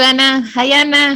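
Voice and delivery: usual speaking voice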